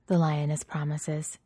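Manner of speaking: plain reading voice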